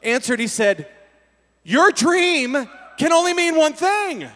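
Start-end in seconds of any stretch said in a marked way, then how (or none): 1.6-4.3 higher, louder urgent warning; urgent, exasperated friend; sober, alarmed friend. Retold or imitated. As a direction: snap him out of it with alarm. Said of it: "who was clearly sober".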